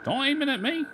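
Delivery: hammed-up fear of the archer; mock panic. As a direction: yelp it like a scared cartoon. Archer NPC aims bow at him.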